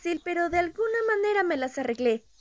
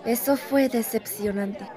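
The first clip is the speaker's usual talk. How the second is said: disappointed; 6 semitones lower than usual. Acted. After the bad sale.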